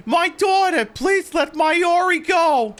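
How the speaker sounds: high-pitched distraught mother, begging; pleading mother. Acted. Mother begging cultists to let her daughter go, high desperate plea. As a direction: cry out in a put-on high mother's voice.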